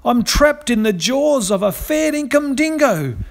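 high, panicky bird voice pleading; frightened cry for help. A narrator's reading. Trapped cockatoo begging the birds above, high terrified plea. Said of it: "he cried out".

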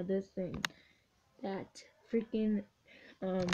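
normal talking voice

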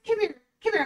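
normal talking voice

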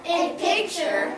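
loud, slow choral "a picture"; class echo. Real, not acted. Students echoing the teacher, slow and loud. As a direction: shout it back together. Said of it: "Repeat after me".

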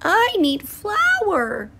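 high, slow, sad hen. A narrator's reading. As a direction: sigh it out like a hen. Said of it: "she said".

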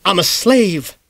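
high, hammed-up martyr wail. A narrator's reading. Miss Greenglass wailing to her sister, mock martyrdom. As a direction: moan it like a put-upon martyr. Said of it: "Miss Greenglass wailed".

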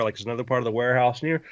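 normal talking voice

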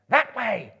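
high, loud contrary yell; goblin yell. A narrator's reading. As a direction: yell back the other way. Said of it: "others yelled".

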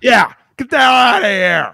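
high, loud, drawn-out bellow; coach's shout. Retold or imitated. Coaches kicking someone out, hammed-up shout. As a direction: shout them out of the room.

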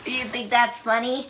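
high squeaky voice; helium voice. Real, not acted. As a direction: ask it on helium. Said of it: "ah, my voice".

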